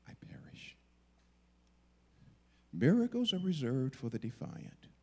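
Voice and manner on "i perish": barely voiced, very soft whisper; solemn whisper. Preacher echoing Esther's resolve to face the king, whispered. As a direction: breathe it out as a quiet acceptance.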